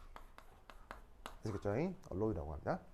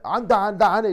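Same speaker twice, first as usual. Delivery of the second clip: loud refusal; 4 semitones higher, 14 dB louder than usual. Retold or imitated. Governor quitting the Olympics.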